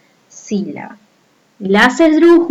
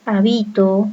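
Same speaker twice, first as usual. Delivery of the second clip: habito with the stress on BI; stress on middle syllable; 4 dB quieter than usual. Shown as a demonstration. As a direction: lean on the second syllable of habito. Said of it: "bi suena más fuerte".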